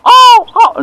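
very high, loud wail; calf's cry. Retold or imitated. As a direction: bawl like a frightened calf. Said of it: "the calf's going".

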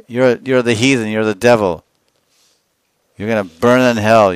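imitated heckler's loud insults; acted angry passer-by, loud and scornful; hurling abuse. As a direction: hurl each insult hard, starting at a high shout. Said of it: "so many dirty words hurled at us".

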